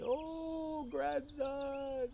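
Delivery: high-pitched, drawn-out wailing coo; crying drunk grandmother. Retold or imitated. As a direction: imitate a tearful granny clutching her grandson. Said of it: "just start crying and hug me".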